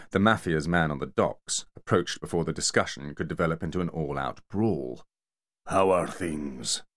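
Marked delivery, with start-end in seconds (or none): 5.7-6.8 slow, big-voiced dock man; slow bear-like Yakut voice. Yakut greeting the two enforcers, slow heavy voice.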